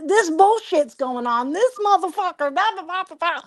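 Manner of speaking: high-pitched mock rant; imitated ranting. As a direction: imitate a kid venting, pitch climbing high. Retelling son's angry rant by phone.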